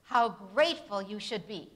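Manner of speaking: loud exclamation, stress on How, falling; exclamatory. Shown as a demonstration. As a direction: exclaim it with strong feeling. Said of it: "an exclamatory sentence".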